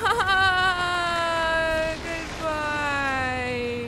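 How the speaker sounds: strained, near-sobbing cry. Real, not acted. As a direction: cry out while escaping. Escaping monster.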